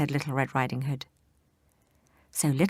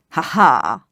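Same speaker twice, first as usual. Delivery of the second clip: wolf laugh; 10 dB louder than usual. Acted.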